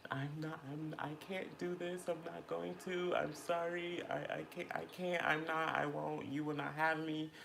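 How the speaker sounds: high, anxious, pleading refusal; scared refusal. Retold or imitated. Retelling refusing out of fear of looking stupid, high scared plea. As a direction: refuse in a frightened, breathless plea.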